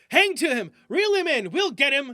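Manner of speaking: loud, high shouted orders; shouting. Acted. F chasing the turkey, shouting to Jones. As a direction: urge him on at full voice. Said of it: "shouted F".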